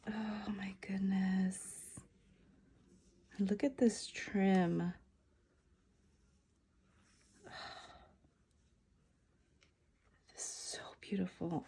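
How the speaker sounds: awed hushed whisper. Real, not acted. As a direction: whisper as if in a museum. Whispering in awe over trim.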